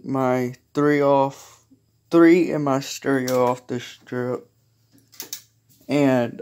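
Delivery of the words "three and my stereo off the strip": ordinary delivery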